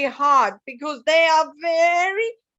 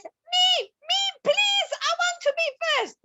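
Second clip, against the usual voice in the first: much higher, pleading piggy voice, quick at the end; Piggy begging the teacher for first turn, much higher; eager piggy; 14 semitones higher than usual. Acted. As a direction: beg to go first like an impatient piglet. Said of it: "And Piggy says".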